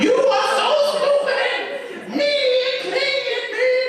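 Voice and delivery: high, slow, laughing yell; mock yelling at himself in the car; mock car rant. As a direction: rant alone in the car, hammed up. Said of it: "yell and scream all the way home".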